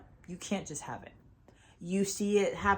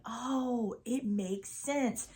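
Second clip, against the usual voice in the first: soft, slow "oh" of sudden understanding; dawning realization; 4 semitones higher, 4 dB quieter, much slower than usual. Retold or imitated. Retelling to viewers the moment it finally clicks, softly. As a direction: let it click quietly, drawing it out. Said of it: "'cause you'll be like".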